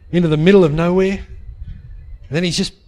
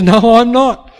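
louder, a curt retold refusal from the captor; mock angry shout; 4 semitones higher, 8 dB louder, slower than usual. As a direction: bark it like a defiant kidnapper. Kidnapper refuses her.

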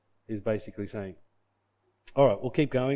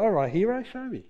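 higher, teasing dare from a sceptic; mocking challenge; 7 semitones higher than usual. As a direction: throw down a smug challenge, half-amused. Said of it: "so he kinda leans back and goes".

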